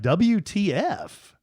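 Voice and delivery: drawn-out incredulous letters; retold disbelief. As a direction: spell it out letter by letter in mock outrage. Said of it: "I just was like".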